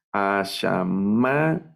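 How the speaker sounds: Ashamayim very slow, long stressed MA; acento en MA. Shown as a demonstration. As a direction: stretch Ashamayim syllable by syllable, leaning on MA. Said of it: "Aquí va el acento". (Ashamayim muy lento, alargando la sílaba MA)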